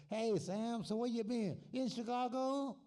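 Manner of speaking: put-on high, loud old man greeting a friend. Old friend hailing Sam in Jackson, high old man voice. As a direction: pitch it up like a chirpy old timer calling out.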